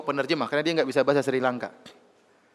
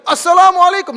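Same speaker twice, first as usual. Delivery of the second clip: shouted greeting, much higher and louder; 13 dB louder than usual. Retold or imitated. Police chief greeting the idol in the temple, shouting. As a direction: call the greeting out at full volume. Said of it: "dengan suara yang keras".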